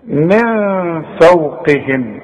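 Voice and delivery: من فوقهم slowly with ن hidden and nasal; slow demo. Shown as a demonstration. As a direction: draw out من فوقهم. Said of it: "من حروف الإخفاء".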